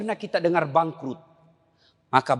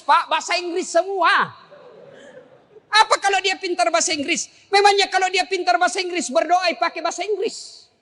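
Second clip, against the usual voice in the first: fervent preaching; 13 semitones higher than usual. Acted. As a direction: drive the point home to the crowd. Preacher mocking worldly cleverness. (ceramah berapi-api, nada tinggi)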